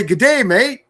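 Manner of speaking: high, loud Australian "Good day, mate."; mock Aussie g'day. Shown as a demonstration. Coaching the viewer, a loud Aussie g'day. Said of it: "You need to say".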